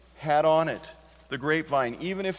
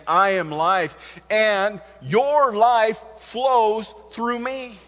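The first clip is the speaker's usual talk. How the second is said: slow, louder, rising to higher pitch; emphatic preaching; 6 semitones higher, 8 dB louder, slower than usual. Acted. Preacher closing his point to the congregation, slow emphatic preaching. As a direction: lay each word down slowly and grandly. Sermon climax, slow and loud.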